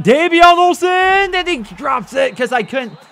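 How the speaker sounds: high, slow excited shout; thrilled shout at the catch; excited yell. Real, not acted. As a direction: yell it out as the pass drops. Excited call of deep ball.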